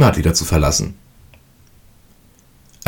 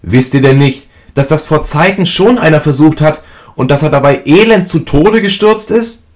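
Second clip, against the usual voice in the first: much higher and louder, slowing at the end; anxious mother; 10 semitones higher, 5 dB louder than usual. A narrator's reading. Mother warning her sons, high, loud and anxious. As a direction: press them with fear. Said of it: "forschte die Mutter".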